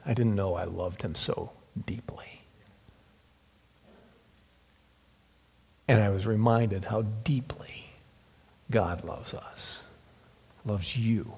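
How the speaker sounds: hushed, breathy whisper, nearly gone; almost whispered, overcome. Real, not acted. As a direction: overcome preacher whispering of God's love. Emotional reflection on love.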